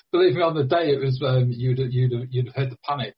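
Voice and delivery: words bubbling with laughter; laughing while talking. Real, not acted. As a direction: talk through a nervous laugh. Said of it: "I can hear the smile in your voice too".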